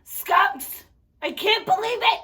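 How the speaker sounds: high, loud exasperated cry; hammed-up outrage. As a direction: splutter in play disgust after being sprayed. Just sprayed by pretend skunk.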